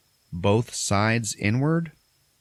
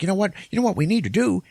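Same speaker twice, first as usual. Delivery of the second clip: ranting radio host; 13 semitones higher, faster than usual. Retold or imitated. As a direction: hype up the listeners like a shock-jock on air. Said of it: "radio talk show host who's like".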